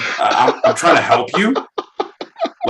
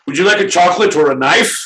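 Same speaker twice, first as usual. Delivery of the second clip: quick, higher put-on accent; a jokey Swiss vendor, higher; mock Swiss salesman; 5 semitones higher, 3 dB louder, faster than usual. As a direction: offer the goods in a fake Swiss lilt.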